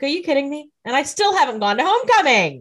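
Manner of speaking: hammed-up teen outrage; mock outrage. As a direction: complain like a teen robbed of homecoming. Rebecca upset about her dress.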